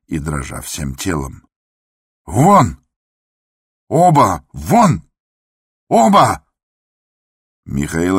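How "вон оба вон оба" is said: high, loud explosive commands; enraged yelling; furious shouting. A narrator's reading. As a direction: throw them out, one shouted word at a time. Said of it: "продолжал кричать Андрей Ефимович".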